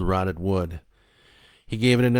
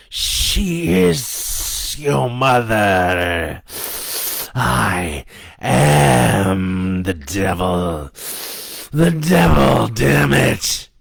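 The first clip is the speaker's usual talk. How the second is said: loud, very slow demonic bellow; devil crying out; 3 semitones higher, 7 dB louder, much slower than usual. A narrator's reading. Devil insists to the boy on who it is, crying out. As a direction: stretch each word into a devilish roar. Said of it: "the devil cried".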